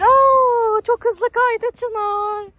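high excited gasp, slowed; amazed exclamation at a fast slide; surprised. Real, not acted.

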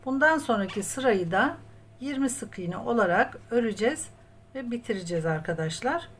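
ordinary delivery

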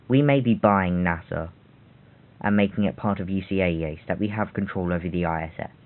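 normal talking voice

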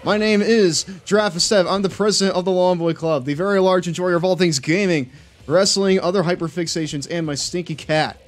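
hammy announcer voice; show host intro. Acted. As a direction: open the show like a grand host. Hosts mock show intro.

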